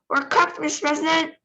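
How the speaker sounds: high, mild panicked shout. Acted. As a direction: call out that all is lost. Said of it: "shouted Shanks".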